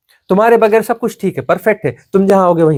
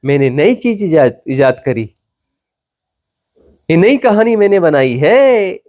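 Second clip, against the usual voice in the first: ordinary talk, nothing marked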